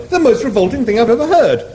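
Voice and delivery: high appalled Englishman; Arthur appalled. Acted. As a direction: squeal with disgust.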